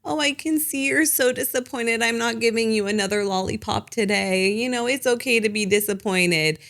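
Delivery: lifted, sweet soothing parent voice; gentle parent voice. Retold or imitated. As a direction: coo it like a gentle parent consoling a disappointed child. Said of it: "my gentle approach like".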